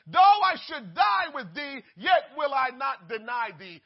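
loud, high, fervent oath; shouted vow. Acted. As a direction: swear it at the top of your voice. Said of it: "Peter had just promised the Lord".

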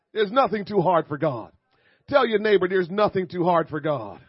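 loud preacher's declaration, falling at the end; emphatic pulpit call; fired-up preaching. Real, not acted. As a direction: proclaim it loudly to the congregation. Preaches to congregation.

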